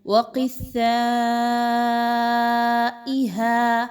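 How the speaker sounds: وقثائها with ق and ث pressed together, very slow and stretched; teacher models the ق ث join in وقثائها; firm join. Shown as a demonstration. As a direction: draw out وقثائها, joining ق to ث firmly. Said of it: "مضبوطی کے ساتھ ملانا ہے".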